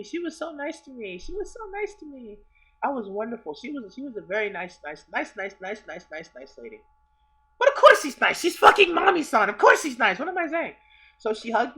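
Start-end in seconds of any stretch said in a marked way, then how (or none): 0.0-2.4 high, soft fan gushing. Real, not acted. Gushing about being hugged. 7.6-10.7 high, loud fan exclamation; loud, pitched-up self-correction; excited outburst. Real, not acted. Bursts out correcting himself, starstruck.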